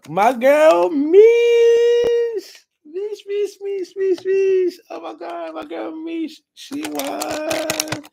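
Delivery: very high squeal, loud then thinning; ecstatic announcement. Real, not acted. Host announcing her girl as winner, ecstatic squealing. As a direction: squeal the name over and over with glee.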